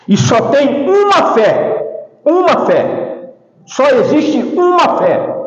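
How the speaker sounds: high, slow declaration with stretched words; emphatic preaching. Acted. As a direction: hammer the point like a preacher. Preaches the key point.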